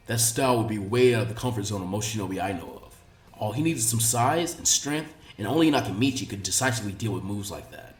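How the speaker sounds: measured, thoughtful sensei voice; lightly voiced Asuma. A narrator's reading. Voices Asuma in fanfic reading.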